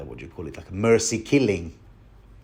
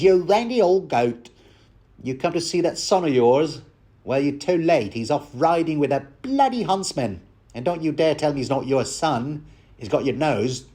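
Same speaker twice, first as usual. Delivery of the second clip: higher, lively Cockney innkeeper scolding, hammed up; Cockney accent; 3 semitones higher than usual. Innkeeper mocking the randy old goat, Cockney. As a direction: scold him as a bawdy Cockney landlady. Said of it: "I did a crap job with the accent".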